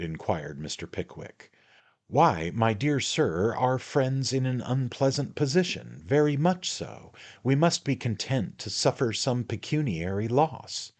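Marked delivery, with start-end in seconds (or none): 2.1-10.9 a hint of a fussy little attorney; little attorney, confiding. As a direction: fuss over him, dear sir. The attorney talks in the story.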